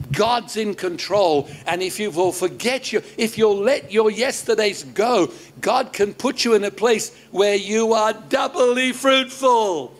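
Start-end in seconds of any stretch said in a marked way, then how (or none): 3.2-9.9 sustained preacher's cadence, driving to the last words; emphatic preaching. Real, not acted. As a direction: build to a ringing pulpit climax. Preacher builds to emphatic promise.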